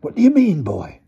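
high, loud alarmed demand; alarmed cry. A narrator's reading. Trapped man calls after the departing boy, alarmed. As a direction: call out in alarm, dropping on the last word.

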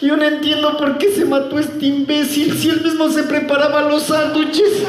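hammed-up weeping wife; high, slow mock crying; sobbing Pastuso widow. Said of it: "lloraba moco tendido".

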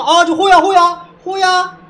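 rising excited call of a name. Real, not acted. Calling out driver during crash.

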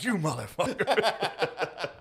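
slow, high, laughing insult; mock comeback. Firing back at the haters, slow laughing mock insult. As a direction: stretch it out, half laughing.

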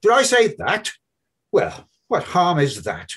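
high, loud mocking young man's voice; Buck Mulligan. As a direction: play the brash medical student, needling his friend.

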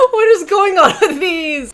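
high, loud, laughing disbelief; high incredulous outburst; bewildered. Real, not acted. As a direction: squeal it in amused bafflement. Baffled by glitching pigeons.

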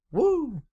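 high-pitched woo; relieved whoop. Real, not acted. Teacher nearly done with the last titles, relieved whoop.

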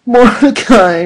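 high, whining call of his name; whiny Rigby. A narrator's reading. Reading fanfic aloud, Rigby whines at Mordecai. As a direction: whine it like a bored kid. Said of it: "Rigby whined".

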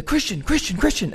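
high, urgent repeated call. Retold or imitated. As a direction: call eagerly to get someone's attention. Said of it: "I hear my name".

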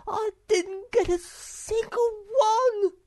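high-pitched, loud tearful wail; Sniff. A narrator's reading. Sniff mourns the lost garnets, wailing. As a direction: wail it slowly, as if sobbing. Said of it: "Sniff moaned".

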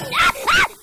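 sudden high shriek; startled scream. Real, not acted. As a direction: yelp at the scare. Jump scare.

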